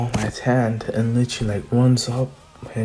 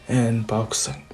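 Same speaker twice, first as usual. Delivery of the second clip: usual speaking voice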